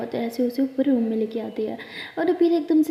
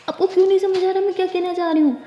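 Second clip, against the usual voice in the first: frustrated plea; 6 semitones higher, 4 dB louder than usual. Acted. Frustrated girl can't say it.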